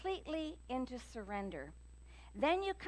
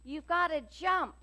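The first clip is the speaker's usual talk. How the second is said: loud, higher yell at the passengers; pilot yelling; 6 semitones higher, 6 dB louder than usual. Retold or imitated. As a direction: shout the order over a failing engine. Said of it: "So he yells at the passengers".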